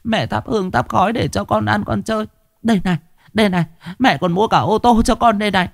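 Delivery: high, keening mother calling to her dead son; mother lamenting at the incense for her son; grieving lament. Acted. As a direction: pour out a mother's sorrow in a sing-song wail. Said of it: "lẩm bẩm".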